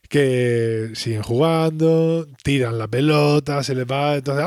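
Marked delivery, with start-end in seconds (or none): none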